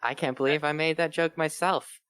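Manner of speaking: normal talking voice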